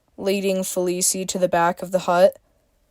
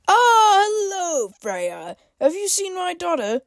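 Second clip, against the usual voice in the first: put-on much higher, cracking old man's voice; chuckling shopkeeper; 11 semitones higher than usual. A narrator's reading. Shopkeeper chuckling as he greets Freya in a high voice. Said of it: "he chuckled".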